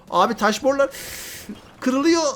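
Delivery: startled cry as the pipes crack; alarmed surprise. Real, not acted. Commentator alarmed as the stone pipes break, rising high.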